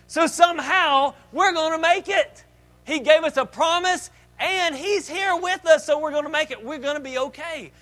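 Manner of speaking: loud, high rallying cry over the storm; confident shout. Acted. Disciples in a sinking boat cheering each other, loud and high. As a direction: shout faith over the wind, slowing on the promise. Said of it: "They should have said".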